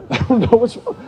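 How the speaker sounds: high, loud imitation through laughter; laughing mock blurt. Repeating the flustered man's confession to friends, laughing mock blurt. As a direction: blurt it out while cracking up.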